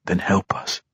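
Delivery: whispering, breathless offer; whispered offer. A narrator's reading. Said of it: "Drizzt offered".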